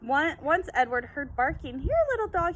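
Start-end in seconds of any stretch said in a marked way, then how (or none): 1.8-2.6 high, louder hopeful call; a boy calling a stray dog. Acted. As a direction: call to a dog you hope is yours. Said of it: "he called".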